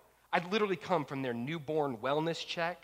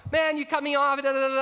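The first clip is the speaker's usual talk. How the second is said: high, loud retold yelling at the driver; mock yell; 10 semitones higher, 8 dB louder than usual. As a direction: play the angry driver shouting. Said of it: "He starts yelling".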